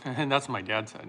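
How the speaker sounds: ordinary talk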